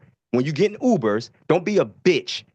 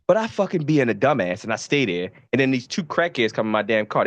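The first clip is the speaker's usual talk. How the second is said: ordinary delivery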